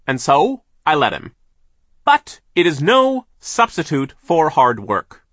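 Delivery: loud, drawn-out, high scolding; pompous, dismissive mill owner; overbearing boss, scornful. A narrator's reading. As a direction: boom out your disdain as the boss.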